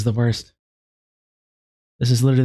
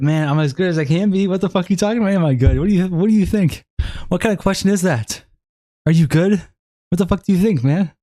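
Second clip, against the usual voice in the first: exasperated retort; 5 semitones higher than usual. Real, not acted. Incredulous retort to chat question.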